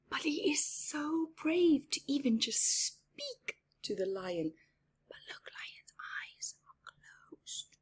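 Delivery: hushed suspense whisper; breathless bedtime-story whisper; whispered storytelling. Acted. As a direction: lean in and whisper to the kids.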